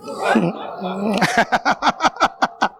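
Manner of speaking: long chuckle in a run of short bursts; staccato laugh. Real, not acted. Preacher amused by his own grammar joke, staccato laugh. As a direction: laugh it out in quick pulses.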